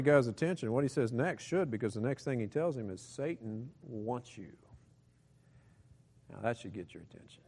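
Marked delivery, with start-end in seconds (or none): 3.0-4.5 ominous quiet. Acted. Ominous reveal, slow and quiet.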